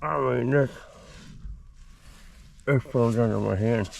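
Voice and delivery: talking with a flashlight in the mouth; words around a flashlight held in the teeth; mouth propped open. Real, not acted. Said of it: "I get the flashlight in my mouth".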